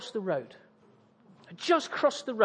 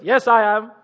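loud, hammed-up British reply; exaggerated British accent; 8 dB louder than usual. Tourist confirming he is British, exaggerated British accent. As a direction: play up the Britishness. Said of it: "I'll just crank up the Britishness".

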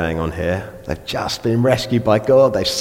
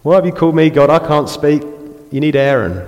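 louder, higher whine of excuses; Moses moaning; 5 semitones higher, 6 dB louder than usual. Retold or imitated. Preacher voices Moses complaining to God, in a loud mock whine. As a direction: whine like you're begging out of it. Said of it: "moaning and complaining".

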